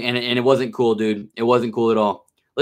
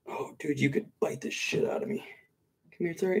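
nervous whisper; 14 dB quieter than usual. Real, not acted. Nervous aside while handling snake.

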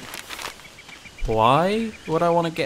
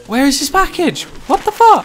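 frustrated shriek; 11 semitones higher, 6 dB louder than usual. Real, not acted. Frustrated outburst.

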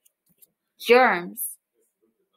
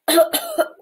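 demo coughs; 6 semitones higher than usual. Shown as a demonstration. Teacher shows children coughing, mock coughs. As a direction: fake coughs, higher.

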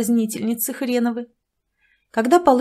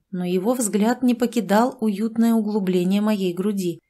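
ordinary delivery

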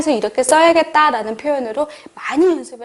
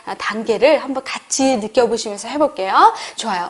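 ordinary talk, nothing marked

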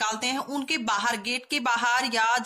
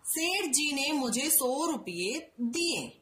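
slow careful reading; slower than usual. Shown as a demonstration. Teacher dictating the corrected sentence slowly to children. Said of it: "सही वाक्य क्या होगा".

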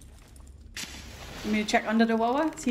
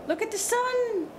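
excited gasp; 10 semitones higher than usual. Real, not acted. Awestruck gamer spots the sun.

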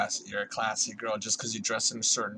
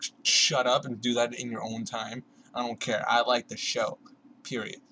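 normal talking voice

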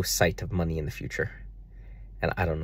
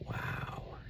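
breathy whispered wow, barely voiced; awed whispered wow; stunned whisper; 13 dB quieter than usual. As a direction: let the wow fall out as a hushed whisper. Stunned reaction to God's words.

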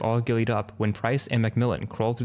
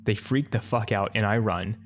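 ordinary delivery

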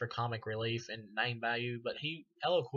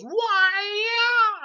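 mock kung fu yell; 13 dB louder than usual. Reviewer mimics the [name] character's kung fu shout. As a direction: squeal it like a cartoon martial artist. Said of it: "He goes".

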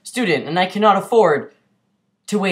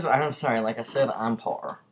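ordinary talk

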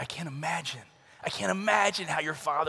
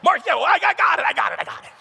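high, loud mock-panicked babble; frantic retold stalling, dropping at the end; flustered; 10 semitones higher, 9 dB louder than usual. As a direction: stammer over the instructor in comic panic. Nervous jumper cuts off instructor.

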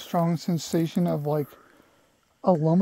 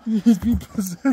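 spooky voice; 7 dB louder, slower than usual. Pretend. Spooky ghost hunt voice.

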